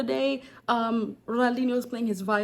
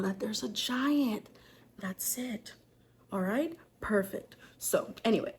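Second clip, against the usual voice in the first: whisper; 4 dB quieter, slower than usual. Real, not acted. Continuing hushed aside.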